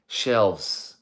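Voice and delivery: usual speaking voice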